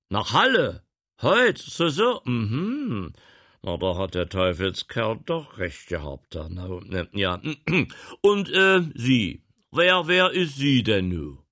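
loud, high echo of Halle; surprised gentleman. Acted. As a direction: mull it over, then demand. Gentleman echoes.

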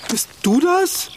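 high, slow, stunned question; can't believe who's standing there; shocked high-pitched question; startled disbelief. Acted. Startled by transformed friend.

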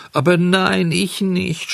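sehr hoch, gespielt weinerlich; schluchzend. Acted. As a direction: weinerlich flehen. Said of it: "schluchzte Bengele". (Bengele schluchzt hoch vor dem Bauern)

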